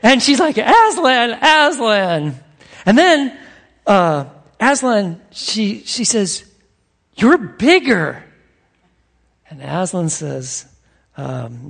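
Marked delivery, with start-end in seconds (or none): 0.6-2.4 joyful calling. Retold or imitated. Lucy greets Aslan. 7.2-8.2 wonder. Retold or imitated. Lucy marvels at the lion.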